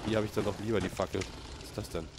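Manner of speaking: normal talking voice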